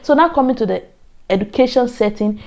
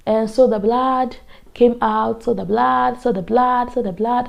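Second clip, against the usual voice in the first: a hint of Ghanaian accent, slow, with "blood" said as "blad"; Ghanaian lecturer; 2 semitones higher, 4 dB quieter than usual. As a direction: slowly drill "blood" as "blad", lightly Ghanaian. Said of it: "she's like".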